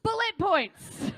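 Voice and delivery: high, slow, loud disbelieving repeat; incredulous echo. Acted. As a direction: echo it back in mock horror. Mocking echo of friend's draft.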